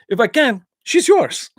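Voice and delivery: mock movie challenge with a high punched finish; Van Damme impression. Finishing the bar bet for viewers, Van Damme impression. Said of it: "he goes".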